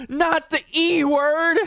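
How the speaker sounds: slow, high, crying with laughter; laughing. Retold or imitated. Streamer repeating the joke while crying with laughter. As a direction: squeeze it out through helpless laughter.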